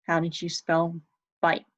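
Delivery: ordinary talk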